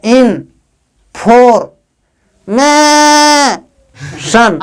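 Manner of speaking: slow piece-by-piece "in for मैं सन"; broken into syllables. Shown as a demonstration. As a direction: sound out each syllable alone, stretching "मैं". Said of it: "कितना syllable में टूटा ये word".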